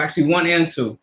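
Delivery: ordinary talk, nothing marked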